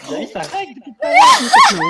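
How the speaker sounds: shouting through laughter; laughing shout. Real, not acted. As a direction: yell it while cracking up. Laughing at friend recording.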